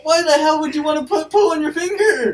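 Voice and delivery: high, loud, fast disbelief; shrieking disgust. Real, not acted. Reacting to her friend's toilet paper hack, shrieking in disgust.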